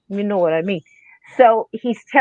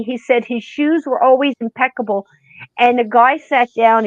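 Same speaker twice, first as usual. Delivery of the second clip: ordinary delivery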